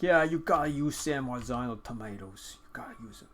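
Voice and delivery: put-on playful Brooklyn accent, New Yorker swagger; lightly voiced Brooklyn accent, insistent. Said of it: "all the New Yorkers are like".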